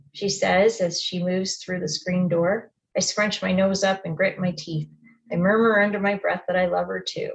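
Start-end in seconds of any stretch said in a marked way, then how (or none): none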